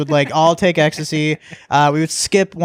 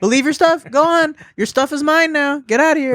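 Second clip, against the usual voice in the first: higher, playful teacher voice shooing him out; teasing teacher; 10 semitones higher than usual. Retold or imitated. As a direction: shoo the student out with mock sternness. Teacher teasing stoned student.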